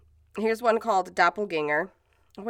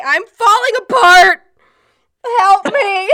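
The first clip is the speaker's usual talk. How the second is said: in pain; 10 semitones higher, 12 dB louder than usual. Real, not acted. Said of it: "if I sound like I'm in pain".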